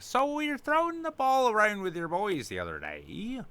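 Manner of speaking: put-on high, loud Western Canadian accent. Fan showing off Letterkenny talk, Western Canadian accent. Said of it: "I learned the Western Canadian accent from watching Letterkenny".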